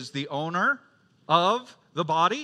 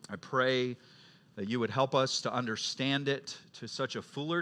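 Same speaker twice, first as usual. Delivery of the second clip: soft, lower, hushed prayer voice; quiet prayer; 5 semitones lower, 5 dB quieter than usual. Acted. Pastor closing the sermon with a hushed prayer. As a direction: pray gently to the Father, winding down.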